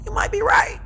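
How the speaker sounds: high-pitched, mock-reluctant admission; sheepish concession. Client conceding to therapist, high and sheepish.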